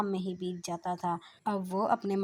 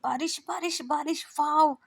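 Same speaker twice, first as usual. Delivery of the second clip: excited shout; 8 semitones higher, 5 dB louder than usual. Acted. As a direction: shout with joy at the rain. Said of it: "kitchen से ही चिल्लाती है".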